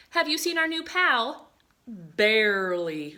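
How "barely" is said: drawn-out slow bear, louder. Acted. Teacher reading the bear's pun to the class, slow and loud. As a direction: stretch the word out like a big bear.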